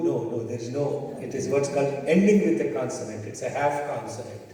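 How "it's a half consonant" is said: ordinary talk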